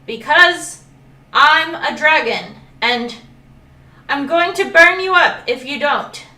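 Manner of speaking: loud, high furious threat. Acted. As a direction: warn it you will burn it. Dragon threatens crocodile.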